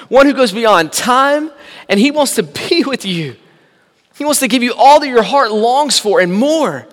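rising sermon fervour; preaching at full force. Acted. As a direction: drive it home from the pulpit.

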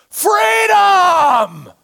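full-throated battle cry; defiant yell. Retold or imitated. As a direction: hurl it out like Braveheart defying his killers. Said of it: "They can't stop him from yelling".

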